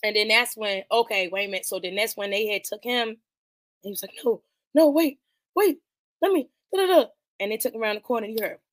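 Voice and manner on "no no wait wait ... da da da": rising, higher panicky begging; mock pleading. Man begging as guards drag him away, panicked. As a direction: imitate a scared man pleading to wait.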